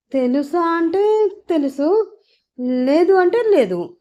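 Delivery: high, drawn-out hedging; silly evasive answer. A narrator's reading. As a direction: stretch it out awkwardly in a lifted voice. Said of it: "తింగర సమాధానం ఒకటి చెప్పింది".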